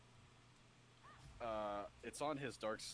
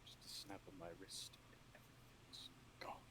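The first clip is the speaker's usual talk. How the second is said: soft sinister whisper; 3 semitones lower, 10 dB quieter than usual. Acted. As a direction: breathe it like a threat. Reciting villain quote in whisper.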